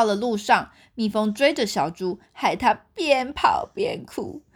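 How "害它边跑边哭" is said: plain reading voice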